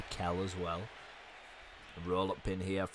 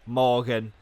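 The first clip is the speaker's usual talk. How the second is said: announcer call; 5 dB louder, slower than usual. Acted. Announcer-style name call.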